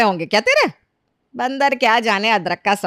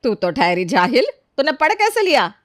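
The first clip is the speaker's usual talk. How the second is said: incredulous challenge climbing high; scornful suspicion; 5 semitones higher than usual. Acted. Mistress doubting maid can read, scornful suspicion. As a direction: question the servant disdainfully.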